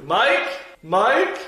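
loud, high call across the room. Real, not acted. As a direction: shout for him to come. Calling for help from Mike.

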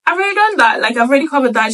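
quick high eager reply, pitch jumping up; bright relieved aside, mock eager. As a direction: chirp it out like it's already handled. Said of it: "And she was like".

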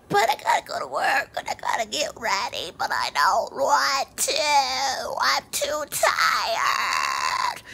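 lightly put-on whiny voice, dragged slow; a hint of a drawn-out sleepy whine; whiny tired voice. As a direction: whine about being too tired. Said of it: "I don't know why I did that voice".

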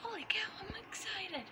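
breathless excited whisper; hushed, thrilled whisper. Acted. Toy character whispering excitedly.

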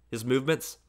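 usual speaking voice

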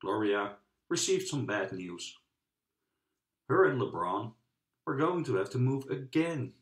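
ordinary delivery, nothing marked

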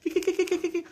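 high, fast giggle imitating the amused parents; mock giggling. Vendor mimicking parents laughing at his cart, high mock giggle. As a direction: titter like the parents laughing at him. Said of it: "They're like".

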